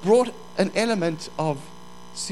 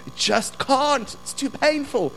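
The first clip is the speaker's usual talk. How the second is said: pained complaint; 8 semitones higher than usual. Acted. Voicing the weary person's complaint.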